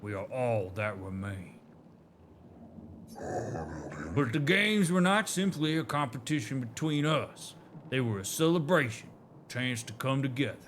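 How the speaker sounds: light Southern drawl for a storytelling elder; a hint of a Southern drawl, proud and nostalgic. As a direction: read it like a Southern elder recalling old glories. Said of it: "the southern molasses coming out of their mouths".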